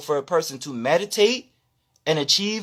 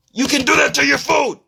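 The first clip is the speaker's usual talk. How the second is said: louder and higher, punching do; shouted repeat; 5 semitones higher, 3 dB louder than usual. Real, not acted. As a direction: shout it back at them.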